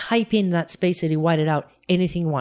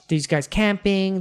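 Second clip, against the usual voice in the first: normal talking voice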